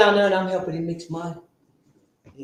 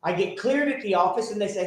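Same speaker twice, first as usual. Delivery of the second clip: normal talking voice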